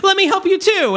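high falsetto too; mock eager helper. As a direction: chime in like an overeager helper. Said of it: "Robin Williams is like".

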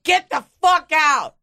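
high, loud mock kick-out; mock outburst. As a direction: shove him away with a hammed-up yell. Imagined outburst at lover.

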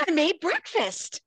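high, perky offer; acted eager-to-please chirp; chirpy hostess. Heroine brightly offers killers breakfast.

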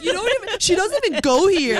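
high, loud put-on quote voice; a lightly played mean-girl line; quoting a movie line. Quoting a movie line for friends, lightly played mean-girl voice.

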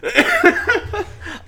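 breathless high laugh; high squealing laugh. Real, not acted. Laughing at cupcake joke.

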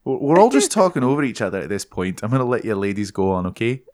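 chatty put-on Scottish; Scottish accent. Rick lets the ladies go on, Scottish accent. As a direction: hand over in a Scottish lilt. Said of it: "totally turned into someone".

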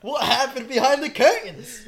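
loud, high, drawn-out question through laughter; stretched-out laughing question; laughing tease. Real, not acted. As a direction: tease them while cracking up.

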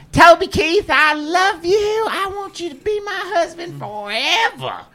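high, slow, lovestruck holler; shouted declaration. Retold or imitated. Caller declaring love in the bar to win tickets, hollering. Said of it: "shout this out right now".

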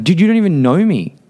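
high-pitched angry protest; indignant rage. Retold or imitated. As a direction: retell it with pitch shooting up in outrage. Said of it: "like, I would rage".

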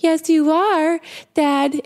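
high mock-tearful insistence; crying little girl. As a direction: imitate an upset child contradicting Daddy. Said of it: "she's crying and she's crying".

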